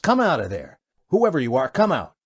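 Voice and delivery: loud barked order; commanding shout. Acted. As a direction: shout them out of hiding. Captain orders hidden native out.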